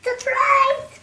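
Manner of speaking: high, loud gleeful cry; spring it on her like a trap; cried surprise. A narrator's reading. Wolf bursting in on Granny, loud gleeful cry. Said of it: "cried the wolf".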